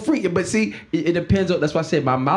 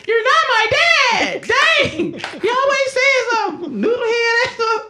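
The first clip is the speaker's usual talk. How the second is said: put-on high, slow whiny child voice, much higher; bratty kid whine; slower than usual. A teen whining at his stepdad, high and slow. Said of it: "Jesus running up in Joseph's face like".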